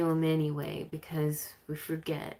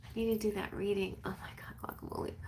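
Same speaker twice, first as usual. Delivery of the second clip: hushed, unvoiced whisper; whispered aside; 2 semitones higher, 8 dB quieter, much faster than usual. Real, not acted. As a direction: whisper it under your breath. Whispered aside about forgotten reading.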